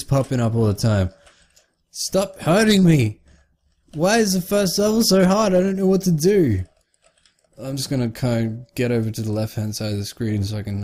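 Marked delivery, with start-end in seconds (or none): 1.9-6.6 high, distressed whining plea; real frantic frustration, pitched way up; helpless wail. Real, not acted. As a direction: wail helplessly while getting beaten. Being beaten, helpless at the game.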